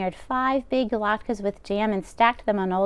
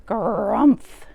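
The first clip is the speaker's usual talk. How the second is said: a loud, grumpy bear grumph; bear growl; 5 dB louder than usual. Acted. Old Bear answering the old woman with a grumph. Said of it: "growled Old Bear".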